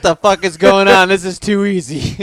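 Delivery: high, loud mock disbelief; disbelieving shout. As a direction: yell it in amazed disbelief. Mock disbelief at easy sale.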